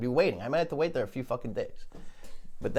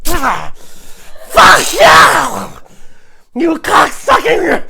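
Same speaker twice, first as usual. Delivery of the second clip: loud, higher, very slow howl; pained furious shout; 16 dB louder, much slower than usual. Acted. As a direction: howl it out as you're bitten. Turkey bitten, screaming back.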